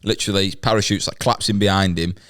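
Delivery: ordinary talk, nothing marked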